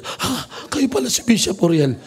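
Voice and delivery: high, surprised exclamation; shocked gasp. Retold or imitated. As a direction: gasp in disbelief. Nurse shocked to learn identity. (gulat na gulat)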